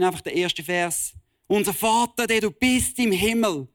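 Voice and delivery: fiery preaching. Real, not acted. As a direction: preach it out with full conviction to the congregation. Preacher at full force. (kraftvolle Predigt)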